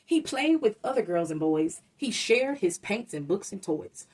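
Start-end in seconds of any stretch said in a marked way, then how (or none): none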